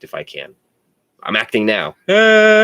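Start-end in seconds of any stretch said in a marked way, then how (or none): 2.1-2.6 high loud mock shriek of self-catching. Real, not acted. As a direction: yelp as if catching himself out. Caught calling himself actor.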